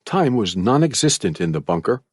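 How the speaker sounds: usual speaking voice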